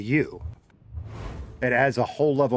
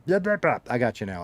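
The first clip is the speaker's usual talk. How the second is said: normal talking voice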